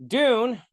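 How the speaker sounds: Dune said high and loud with a falling finish; grand restart. Shown as a demonstration. Restarting his Dune review, bold emphatic title. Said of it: "Here we go".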